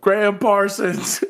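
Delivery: high, drawn-out mock heckler call; retold crowd yell. Retelling a heckler at the gig, mock yelled request. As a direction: shout the request slowly from the back of the bar. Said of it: "somebody in the crowd yelled".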